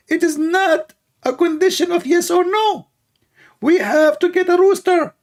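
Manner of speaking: high mocking preacher voice; squeaky sermon mimicking a preacher; Ali Dawa. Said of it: "Let us ask Ali Dawa why".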